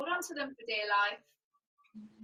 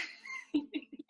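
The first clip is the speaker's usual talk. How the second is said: short laugh; 5 dB quieter than usual. Real, not acted. Laughs at own slip.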